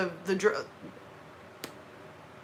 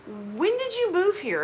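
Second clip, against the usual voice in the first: higher, slow mock questioner; nosy questioner; 8 semitones higher than usual. Asking a local when they moved here, mimicked. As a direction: lightly mimic a puzzled local, slowly. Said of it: "constantly get asked".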